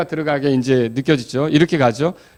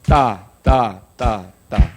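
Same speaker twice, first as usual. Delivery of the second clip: clapping syllables; 5 semitones lower, much slower than usual. Shown as a demonstration. Teacher chanting a clap rhythm for the class. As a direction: call out ta ta on the beat. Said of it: "시작".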